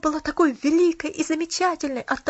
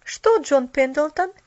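slow, louder inquiring question; slightly raised, curious newcomer's question; 3 semitones higher, 6 dB louder, slower than usual. A narrator's reading. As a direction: ask as if just walking in. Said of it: "послышался новый голос".

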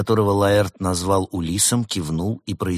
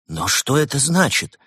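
high, baffled question; puzzled; 8 semitones higher than usual. A narrator's reading. Professor Вольта baffled by the term, puzzled. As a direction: raise the pitch in bewilderment. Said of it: "недоумевал Вольта".

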